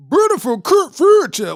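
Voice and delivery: mock the Memphis accent, yelling it out. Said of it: "with that Memphis accent".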